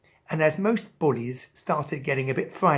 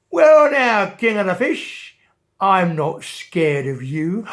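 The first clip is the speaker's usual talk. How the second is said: bully shark squaring up to the king; cheeky shark voice; 3 semitones higher, 9 dB louder than usual. A narrator's reading. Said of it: "and said".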